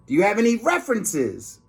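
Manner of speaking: lightly voiced grumpy principal; a hint of a gruff principal.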